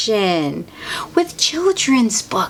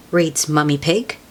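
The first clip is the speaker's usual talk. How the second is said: ordinary delivery, nothing marked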